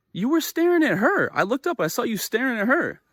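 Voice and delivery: very high-pitched indignant accusation; reenacted accusation. Accusing the man of staring at her, high indignant voice. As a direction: act out protesting, voice shooting up high.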